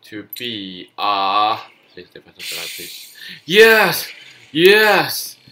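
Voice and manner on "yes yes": loud, high shouts of joy; triumphant cheer. Real, not acted. Gamer finally winning a round, triumphant cheer. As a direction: celebrate the win at full volume. Victory moment in game.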